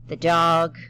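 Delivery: usual speaking voice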